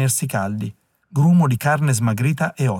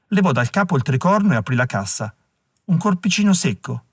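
ordinary delivery, nothing marked

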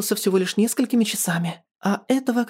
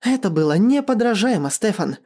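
higher-pitched interjection; cutting in; 6 semitones higher than usual. A narrator's reading. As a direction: cut him off with emphatic praise. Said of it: "перебила его Виктория".

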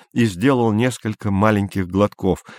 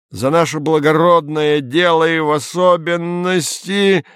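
yelling toast; 7 semitones higher than usual. A narrator's reading. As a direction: shout the toast over everyone. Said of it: "заорал Изя".

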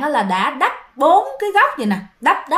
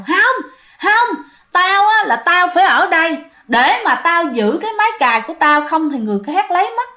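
high, stubborn ghost voice; possessed Tê refusing the monk's pleas in the dead man's voice; 6 semitones higher than usual. Acted. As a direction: refuse like a spirit clinging to its place. Possessed man refusing the monk.

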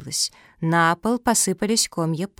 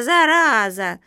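loud, high, stretched cry; panicked cry; 4 semitones higher, 6 dB louder, slower than usual. A narrator's reading. Turtle Zoya fearing infection, panicked. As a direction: wail it out in alarm. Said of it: "перепугалась Зоя".

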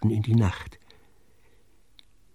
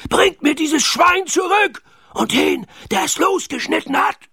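high, strained villain's roar; Knurrhahn roaring. A narrator's reading. Pirate captain demands pig and culprit, roaring. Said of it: "brüllte Knurrhahn".

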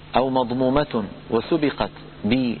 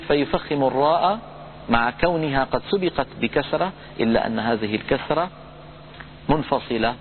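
ordinary delivery

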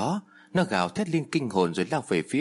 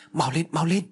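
high loud hurry-up; urgent urging; 6 semitones higher, 3 dB louder than usual. Acted. Medium urges the girl up the well, urgent shout. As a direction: push them to climb faster. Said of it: "Cô Đồng Loan giục".

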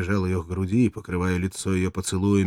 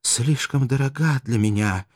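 weak sad voice; 6 semitones higher, 3 dB louder, slower than usual. Acted. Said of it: "слабым печальным голосом".

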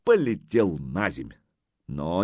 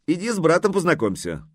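raised-pitch summons; calling out; 8 semitones higher, 3 dB louder than usual. A narrator's reading. Wife inviting husband to meet her brother, calling out. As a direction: call him over to join you. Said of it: "кликнула Дана мужу".

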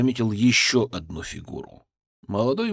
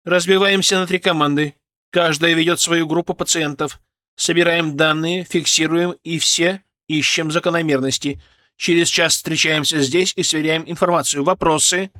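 firm, raised instructing voice; commanding orders; 5 semitones higher, 5 dB louder than usual. Acted. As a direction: give orders, brisk and sure. Doctor gives team orders.

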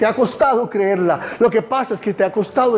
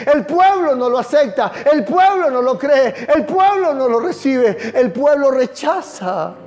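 full-force preaching; 8 semitones higher than usual. Acted. Pastor crying out to his church, full-force preaching. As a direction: preach it out, pleading and insistent.

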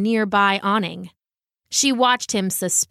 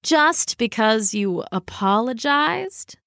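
slow, drawn-out feline question; sceptical cat; slower than usual. Acted. Cat answering the dog's apology, slow and sceptical. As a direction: doubt the apology in an aloof cat voice.

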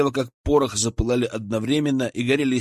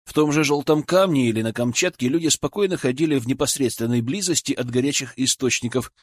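ordinary delivery

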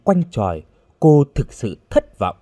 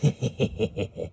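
creepy witch cackle; 9 dB quieter than usual. A narrator's reading. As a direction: cackle like a menacing old witch. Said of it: "Bà già cất tiếng cười ghê rợn". (tiếng cười ghê rợn của mụ phù thủy)

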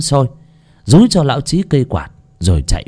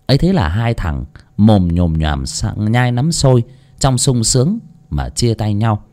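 usual speaking voice

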